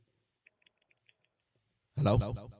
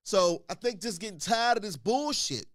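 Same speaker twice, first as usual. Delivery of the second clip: ordinary talk, nothing marked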